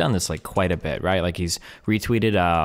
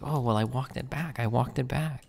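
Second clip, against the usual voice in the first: soft mock whine, pitched up; mocking quiet voice; 6 semitones higher, 7 dB quieter than usual. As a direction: mimic him muttering the excuse. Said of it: "It's like".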